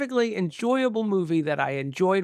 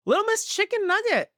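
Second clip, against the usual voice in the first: squeaky, high cartoon voice; high silly voice. Reading patron names in voices.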